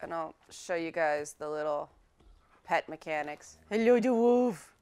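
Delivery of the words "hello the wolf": higher, louder doting greeting; cooing pet talk. Real, not acted. Player greeting her pet wolf, high playful cooing. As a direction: fuss over a beloved animal.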